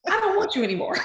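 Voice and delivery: high, hammed-up voice; mock rejection. Husband leaving wife for somebody, high mock rejection.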